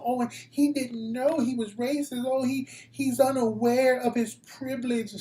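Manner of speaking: high, mocking whiny voice putting on innocence; mocking naive voice. Host mocking people who excuse racism, high and whiny. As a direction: sneer it out like a clueless excuse-maker.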